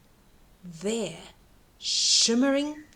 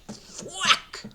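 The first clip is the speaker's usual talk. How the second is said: sharp axe thwack; 7 semitones higher than usual. A narrator's reading. Axe hitting beanstalk.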